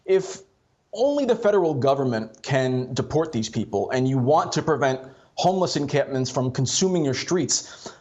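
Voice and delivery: ordinary talk, nothing marked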